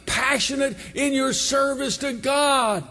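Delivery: high, earnest sermon voice; preacher urging the congregation to passion; fervent preaching. Acted.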